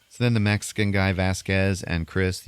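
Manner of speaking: ordinary talk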